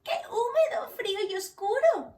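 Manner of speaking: high, squeaky mouse complaining of the cold. Acted. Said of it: "dice Ratón mientras chilla".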